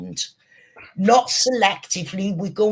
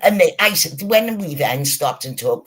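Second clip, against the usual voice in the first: ordinary delivery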